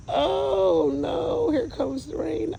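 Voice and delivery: mock whine. Real, not acted. As rain starts, a high mock wail to viewers.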